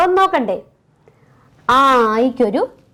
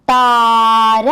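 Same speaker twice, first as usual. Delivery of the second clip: താര stretched slowly; slow drawn-out word; 2 semitones lower, 4 dB louder, much slower than usual. Shown as a demonstration. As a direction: draw out താര with the long aa. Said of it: "എന്ത് ചെയ്യണം?".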